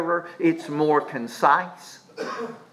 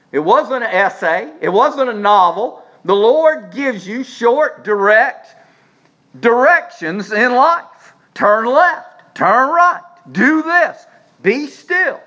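higher, louder, punched short phrases; forceful preaching; 7 semitones higher, 6 dB louder, slower than usual. Real, not acted. Preacher telling congregation God gives short directions, forceful preaching. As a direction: drive each short command home.

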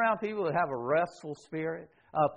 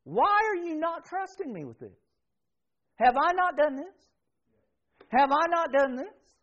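higher, insistent pleading voice; Holy Spirit pleading; 10 semitones higher than usual. Acted. Holy Spirit pressing the believer, high and pleading. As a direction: press the question, rising and urgent. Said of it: "the Holy Spirit saying to you".

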